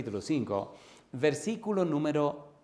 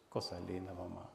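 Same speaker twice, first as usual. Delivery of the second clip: much softer, lower, moved; tender awe; 7 semitones lower, 12 dB quieter than usual. Real, not acted. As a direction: murmur it in wonder. Moved by the tear scene. (con ternura y asombro)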